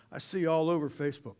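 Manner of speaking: ordinary talk, nothing marked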